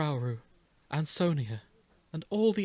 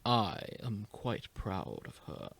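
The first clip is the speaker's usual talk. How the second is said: lightly voiced proud Urbosa, a touch of Matt Berry; hint of Matt Berry; 6 semitones lower, 7 dB quieter, slower than usual. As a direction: let a little of Matt Berry slip in, softly. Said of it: "You keep slipping into Matt Berry".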